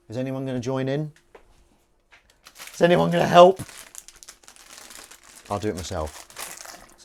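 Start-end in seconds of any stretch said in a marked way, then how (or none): 2.7-3.6 loud, rising plea for help; exasperated call. Real, not acted. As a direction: call out to the room, fed up. No one joins in.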